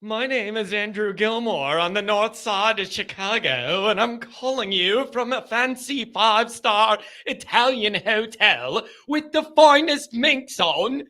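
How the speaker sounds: put-on a made-up Italian accent that sounds nothing like Italy; fake Italian accent. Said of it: "I gave it an accent that has nothing to do".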